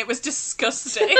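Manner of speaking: ordinary delivery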